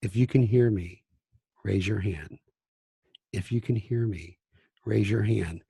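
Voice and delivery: soft, low classroom hush; quiet voice. Shown as a demonstration. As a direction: drop to a low, soft near-murmur. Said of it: "I start talking like this".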